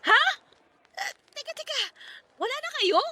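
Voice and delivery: sudden high gasp of surprise, then stalling; shocked disbelief. Acted. Friend hearing the breakup news, high shocked disbelief.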